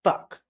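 Fuck with a short uh; American fuck. Shown as a demonstration. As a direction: Fuck said fuhk. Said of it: "This is how the American pronunciation".

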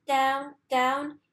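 ordinary delivery, nothing marked

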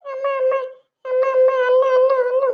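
put-on high, loud little-girl voice; child calling. Little girl calls stranger mother, high and loud. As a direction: cry out for her like an eager toddler.

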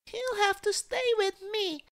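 put-on very high little-girl voice; child crying out. A narrator's reading. Cecily begs to keep the crocodile, high-pitched plea. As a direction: insist in a squeaky child's voice. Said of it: "cried Cecily".

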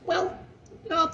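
very high, prissy mock voice; gym Karen voice. Karen asking for his last name, in a very high prissy voice. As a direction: squeak it like a fussy desk clerk.

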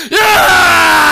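long, high, very loud victory holler; Tarzan yell. Retold or imitated. Mocking the hunter who killed a baby pig, Tarzan yell. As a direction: let out a huge mock Tarzan cry. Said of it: "he acted like Tarzan".

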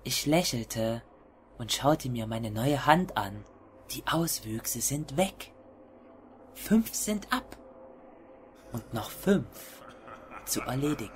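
low, hushed horror story narration; hushed creeping horror. A narrator's reading. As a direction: read it like a creeping, dark confession. (leise, schleichende Horror-Erzählung)

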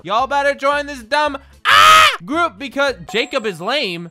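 reads the group's message in a loud mock yell, slowed; mock shout. Streamer reading a group's shout aloud, mock shouting. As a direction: bellow the dumb group message, hammed up.

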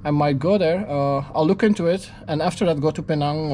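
ordinary delivery

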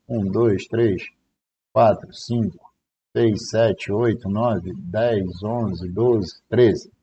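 soft, low countdown; counting under the breath; quiet count. Real, not acted. Counting the seconds to the order, quiet and steady.

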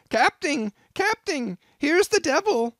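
very high, loud frantic yell. A narrator's reading. Bumpkin yelling to the captain, high loud yell. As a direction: cry out for help at the top of the voice. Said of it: "yelled the bumpkin".

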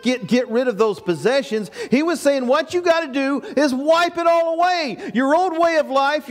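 usual speaking voice

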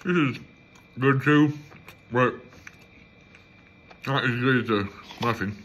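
food in mouth; speaking between chews; talking while chewing. Real, not acted. Seen 2: bite taken at -7; chewing, cheeks moving through the words.

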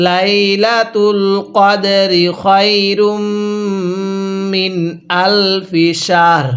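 slow chanted line of verse, light final র in শাহর; tajweed chant; slow recitation. Shown as a demonstration. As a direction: recite the whole line slowly. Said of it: "আমার সাথে পড়ুন".

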